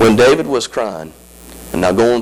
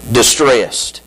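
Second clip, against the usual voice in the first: ordinary talk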